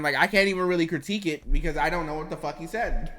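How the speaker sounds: ordinary talk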